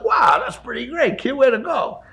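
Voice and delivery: upbeat praise. Retold or imitated. Uncle praising at breakfast.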